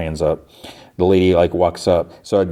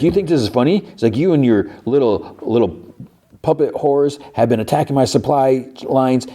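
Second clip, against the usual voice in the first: lightly acted angry confrontation, pitched up; higher-pitched, mild retold outrage; mock indignant; 6 semitones higher than usual. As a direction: imitate an indignant man challenging his mocker. He stands up, confronts mocking woman.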